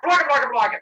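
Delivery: loud, high rapid-fire babble; monster gibberish. Retold or imitated. Monsters reacting to killing the gods, loud high gibberish. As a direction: burst out in monster jabber. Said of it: "I don't speak monster".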